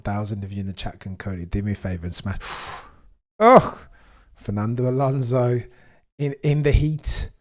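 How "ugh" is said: exasperated groan. Real, not acted. Frustrated at race situation.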